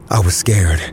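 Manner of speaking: usual speaking voice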